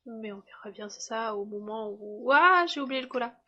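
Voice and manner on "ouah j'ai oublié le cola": sudden loud gasp of realization; surprised exclamation. Real, not acted. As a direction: blurt it out, much louder. Sudden realization of forgotten item. (exclamation surprise, beaucoup plus fort)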